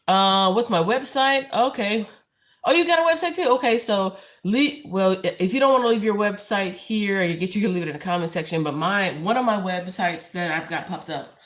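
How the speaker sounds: loud and eager, answering the chat. Real, not acted. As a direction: call it out with energy, a notch up. Excited reply to a viewer.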